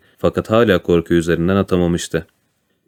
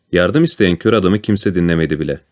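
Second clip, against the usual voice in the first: ordinary delivery, nothing marked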